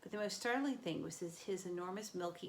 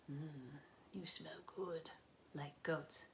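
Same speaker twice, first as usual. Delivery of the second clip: hungry monster murmur; 2 semitones lower, 8 dB quieter, slower than usual. A narrator's reading. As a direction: savour the smell like a predator.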